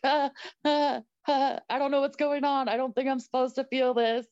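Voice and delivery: high, bewildered giggling while talking; laughing through contractions. Retold or imitated. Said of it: "I was laughing the whole time".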